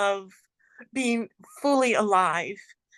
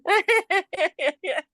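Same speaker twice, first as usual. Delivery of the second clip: joyful high laugh; 11 semitones higher, 3 dB louder than usual. Real, not acted. Joyful laugh after greeting.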